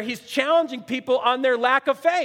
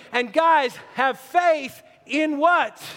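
shouted challenge; 2 semitones higher, much slower than usual. Real, not acted. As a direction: slam the question out to the room. Preacher challenging the congregation.

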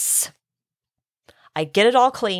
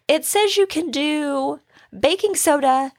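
daughter's voice; 6 semitones higher than usual. Retold or imitated. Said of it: "She was like".

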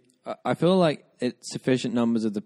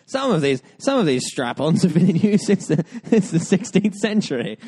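high-pitched mock presenter explaining an old custom; hammed-up ceremonial commentary, rising higher as it goes; mock tradition presenter; 5 semitones higher, 3 dB louder than usual. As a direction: present a silly tradition as grand heritage, pitched up. Mock report on Lords tradition.

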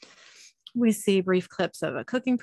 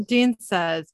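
ordinary talk, nothing marked

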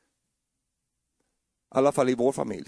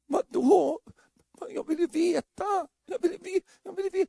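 pleading wife. Retold or imitated. Wife voiced pleading, high.

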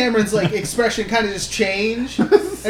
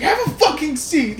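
"Have a fucking seat." barked high in a changed voice; "Have a fucking seat." snapped up high, losing patience; "Have a fucking seat." as daughter loses it with her mom; mock exasperated order; 6 semitones higher than usual. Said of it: "And then it's like".

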